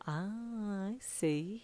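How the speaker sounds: soft, sly whisper. A narrator's reading. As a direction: whisper it knowingly. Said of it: "he said slyly".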